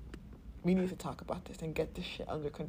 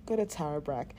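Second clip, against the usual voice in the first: ordinary delivery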